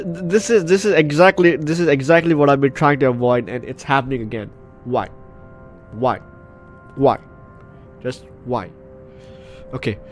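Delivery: usual speaking voice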